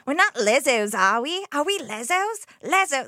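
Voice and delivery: put-on high, loud Australian accent; Naomi Watts. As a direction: exclaim it indignantly in broad Australian. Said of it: "Naomi Watts is just like".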